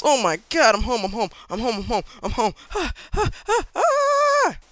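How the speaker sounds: loud, high yelling in a breakneck repeating stream; panicked overreaction. Real, not acted. Gamer flees a million monsters to his house, screaming in panic. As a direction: shout frantically as you flee for home. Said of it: "I overreacted there".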